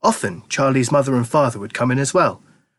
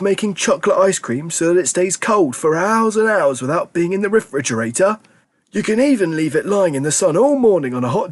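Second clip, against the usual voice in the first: put-on fond old grandpa; 6 semitones higher than usual. A narrator's reading. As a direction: savour the words like an old grandpa. Grandpa Joe voiced.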